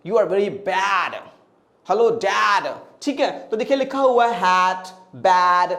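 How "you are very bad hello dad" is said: lower, slow, rounded foreign-sounding English; imitating a fluent foreigner's English accent; posh foreigner English. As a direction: put on a polished native English speaker's voice. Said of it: "कोई अच्छी English बोलने वाला बोलता है".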